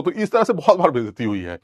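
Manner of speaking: ordinary delivery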